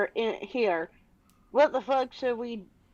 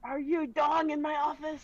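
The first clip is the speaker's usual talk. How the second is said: put-on old man voice; 6 semitones higher, 6 dB quieter than usual. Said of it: "Here's my old man voice".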